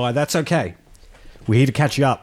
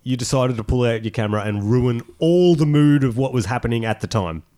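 ordinary talk

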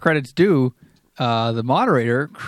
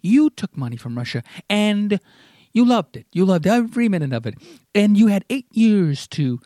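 higher, mock-behaved Trump voice with a far-off timbre; imitating Trump; 7 semitones higher than usual. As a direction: do Trump trying to stay calm, pitched up. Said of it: "'cause he talks like this".

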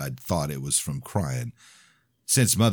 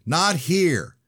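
high, raised cry through a door; shouted; 13 semitones higher, 5 dB louder than usual. A narrator's reading. Danielle shouting through the closed bedroom door, raised voice. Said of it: "Danielle shouted".

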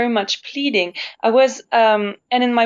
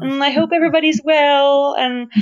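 raised, people-pleasing sweet voice; small apologetic voice pitched up high; high nice voice; 5 semitones higher than usual. Retold or imitated. Singer mocking her old timid self, in a high sweet voice. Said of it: "in this higher position of trying to be nice".

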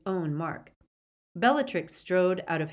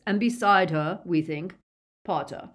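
building, emphatic announcement; mildly exultant reveal; Lucius's triumphant cry; 3 semitones higher, 6 dB louder than usual. A narrator's reading. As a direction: relish the capture, ending louder. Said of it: "cried Lucius".

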